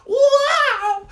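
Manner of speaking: high, loud comic wail; mock flying wail. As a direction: wail like a kid hurled through the air. Child thrown into the tree.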